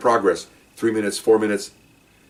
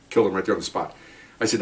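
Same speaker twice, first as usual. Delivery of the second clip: plain reading voice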